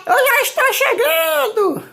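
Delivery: very high, loud, excited doll calling out; a tiny doll shrieking hello from the sky; squeaky doll voice. Acted. Doll arriving by helicopter. (boneca com voz bem aguda e gritada)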